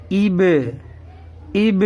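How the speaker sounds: ایب sounded out as "ib" with released b; demonstrated ایب, "ib-uh", each take separate; zer joined to be. Shown as a demonstration. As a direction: join an "i" to b in ایب. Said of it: "وہ زیر کا آئے گا".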